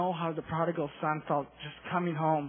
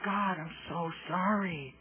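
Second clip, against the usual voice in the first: slow, soft broken-down apology; drawn-out, hushed remorse; tearful apology; 7 dB quieter, slower than usual. Retold or imitated. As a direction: apologize softly, as if near tears. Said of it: "I just cried".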